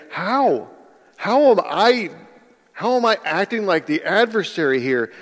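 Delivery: high-pitched, halting "how"; repeated incredulous question, breaking off and restarting; baffled protest. Acted. Accused helper asking how, bewildered protest. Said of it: "might say".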